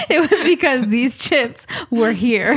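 laughing through the words; slow, giggling delivery. Real, not acted. Laughing retelling.

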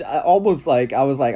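ordinary talk

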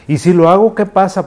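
put-on a kid pushing back, high and loud; child asking.

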